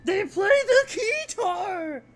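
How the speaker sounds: high, loud delighted cry; thrilled shriek over a guitar-playing goblin; delighted shout. Real, not acted. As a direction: squeal with joy at the discovery.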